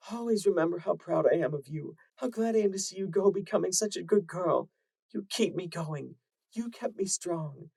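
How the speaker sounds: high, heartfelt mother voice; a mother saying goodbye forever; tender farewell mother. Acted. Mother urging her daughter to live, tender.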